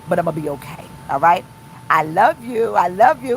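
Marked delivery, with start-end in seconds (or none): none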